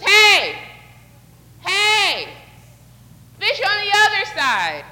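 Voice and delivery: high, loud call across the water; yelling. Acted. Stranger yelling to fishermen from over there, hollered call. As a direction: holler to a far-off boat. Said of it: "he's yelling at us".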